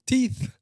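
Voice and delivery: "Teeth" closed on a th, not a t; English pronunciation. Shown as a demonstration. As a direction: say "Teeth" with the English th, tongue between the teeth. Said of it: "as they say in English".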